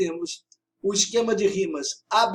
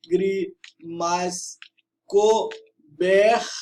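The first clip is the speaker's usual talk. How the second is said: "lágrimas cobertos" very slow, each syllable separate; syllable-by-syllable scansion; 4 dB louder, much slower than usual. Shown as a demonstration. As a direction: count out "lá, gri, mas, co, ber, tos". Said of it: "fazer a escansão do verso". ("lágrimas cobertos" escandido, sílaba por sílaba)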